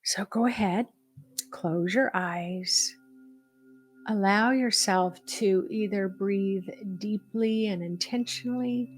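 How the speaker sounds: measured meditation guide with long pauses; guided meditation. Acted. Leading listeners to breathe and feel supported, in a guided meditation voice. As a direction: guide them gently inward, phrase by phrase.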